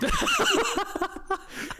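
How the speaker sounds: squealing high laugh. Real, not acted. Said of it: "It was so good".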